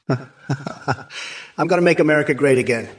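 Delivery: a cackling laugh, then the words in a put-on voice; mock Kamala cackle. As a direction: cackle like a mocking parody of her. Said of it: "I can do a better impression of her cackle".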